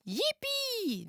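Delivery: high squealing yippee; a gleeful high whoop; excited cheer. A narrator's reading. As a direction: cheer with delight. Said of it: "they cried".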